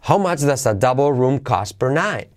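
"double" and "night" accented; question intonation. Shown as a demonstration. Teacher modelling question intonation, "double" and "night" lifted. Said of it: "Acentuaríamos double room cost per night".

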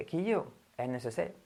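soft mock-Andalusian, final R dropped; Andalusian accent. Teacher jokingly voicing an Andalusian, Andalusian accent. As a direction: play an Andalusian calling a pal "quillo". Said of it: "en Andalucía probablemente lo llamen así".

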